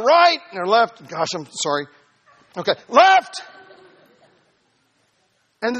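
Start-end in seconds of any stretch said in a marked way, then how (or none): 2.6-3.4 donkey's warning. Acted. Donkey voiced in story.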